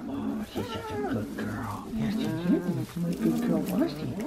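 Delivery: soft, high coo to a dog; cooing pet talk. Real, not acted. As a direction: praise the dog in a tiny high voice.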